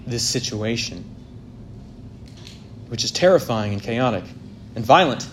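ordinary delivery

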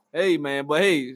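ordinary talk, nothing marked

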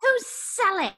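put-on British accent. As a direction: demand to know who Sally is, English accent. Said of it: "I like your accent".

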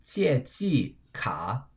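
drawn-out teacher's 借记卡, falling, falling, dipping; two fourths, one third. Shown as a demonstration. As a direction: let 借 and 记 fall, dip on 卡. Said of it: "a double fourth tone".

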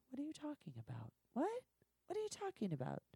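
high-pitched, incredulous questioning; baffled. Retold or imitated. Performer told of an unknown solo scene, baffled. Acting out confusion at surprising news.

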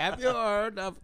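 high, bright call-centre pitch; customer service voice. Pretend. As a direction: sell it like a polite phone agent. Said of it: "[name]'s customer service, uh, voice is coming out".

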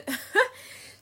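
high breathy burst of laughter. Real, not acted. Bragging about her shape, she bursts into high laughter. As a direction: laugh it out high, almost silent.

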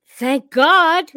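loud, high cry of relief, breaking into laughter; relieved outburst. Retold or imitated. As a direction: blurt it out with huge comic relief. Relieved outburst at police in retelling.

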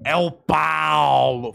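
"É o Paulo" arrastado bem devagar, voz de personagem. Shown as a demonstration. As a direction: demonstra a voz do Paulo pro sobrinho, bem lenta. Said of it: "Fala igual eu". (tio mostrando ao sobrinho a voz do Paulo, bem arrastada)

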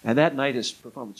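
ordinary talk

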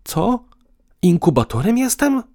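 high-pitched challenge; combative. A narrator's reading. Woman challenges partner over incubator remark, combatively. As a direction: ask it spoiling for a fight. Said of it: "spytała wojowniczo".

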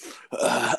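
loud exhale mimicking the roommate; imitated heavy breathing. Annoyed man mimics the roommate heard through the door, heavy breathing. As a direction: breathe out hard, mocking him. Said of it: "and I hear him".

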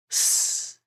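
snake hiss. Acted. Snake hisses.

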